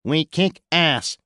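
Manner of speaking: high, slow machine translator voice; flat alien words through a translator box. A narrator's reading. Louis's translator talking to the squad, high and slow. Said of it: "said Louis's translator voice".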